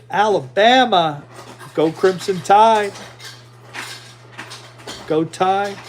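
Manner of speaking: rally for the team, drawn out; cheering. Real, not acted. Cheering for Alabama Crimson Tide to the players, excited.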